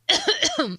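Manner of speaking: a staged, hacking fake cough. Prank caller fakes a cough to sound ill, staged cough. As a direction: cough on purpose to sound sick. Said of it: "I don't sound very well".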